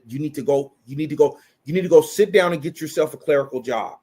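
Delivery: usual speaking voice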